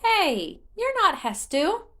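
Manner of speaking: put-on high, bewildered child voice; a tiny sprite puzzled at the wrong visitor. Korok realizing Link isn't Hestu, high bewildered squeak. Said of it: "it sounded bewildered".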